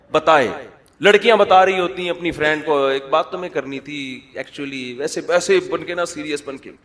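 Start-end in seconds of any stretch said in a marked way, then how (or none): none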